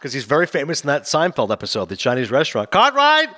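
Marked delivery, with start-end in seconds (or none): 2.7-3.3 much higher, louder excited call; mock shout. Fans quote Seinfeld scene, shouting much higher and louder. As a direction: yell "Cartwright" as fans do.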